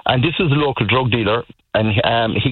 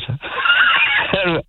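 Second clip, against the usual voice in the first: incredulous laugh. Real, not acted. Disbelief at guards' reply.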